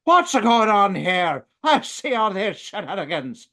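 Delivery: hammed-up cop voice, slow at the start. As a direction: bark it like a baffled Irish cop. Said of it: "He's like".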